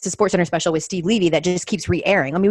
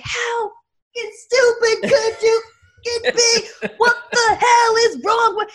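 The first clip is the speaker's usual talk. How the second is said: much higher, mocking shout of rage; angry yelling dad. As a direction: scream it like a livid father, laughing through it. Dad yelling about crashed car.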